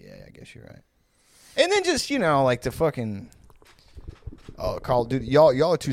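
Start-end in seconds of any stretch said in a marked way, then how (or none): 0.0-0.8 meek murmur. Retold or imitated. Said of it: "I'm like".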